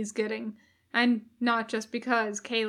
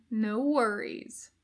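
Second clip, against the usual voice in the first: usual speaking voice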